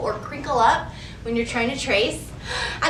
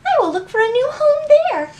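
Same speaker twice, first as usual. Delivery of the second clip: high, quick, eager mouse voice, noticeably louder; little mouse voice; 11 semitones higher, 5 dB louder, faster than usual. Acted. The mouse, having lost her home, resolves to find a new one, in a high, quick, eager voice. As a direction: squeak it out brightly like a tiny hopeful mouse. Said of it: "she said".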